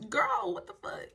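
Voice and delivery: high, incredulous retort; re-enacted disbelief. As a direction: act out being taken aback, pitch jumping up. Re-enacts shocked reaction to threat.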